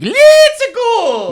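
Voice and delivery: high, loud squeaky Mario shout; over-the-top Italian plumber voice; Mario impression. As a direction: belt it out like the cheery plumber. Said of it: "Mario sounds like".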